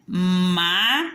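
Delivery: "mala" drawn out as MA... LA while writing; two long separate beats, ma / la; syllable by syllable. Shown as a demonstration. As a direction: dictate "mala" one syllable at a time. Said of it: "Eu vou juntar elas e vou escrever".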